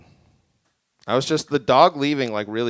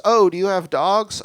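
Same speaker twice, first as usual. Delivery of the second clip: higher, friendly imitated woman; bright woman's voice; 7 semitones higher, 5 dB louder than usual. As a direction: play the cheerful stranger with her dog. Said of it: "and she goes".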